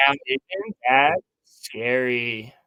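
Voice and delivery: very slow, rising imitation; slow questioning uptalk voice. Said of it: "Every sentence sounds like a question with him".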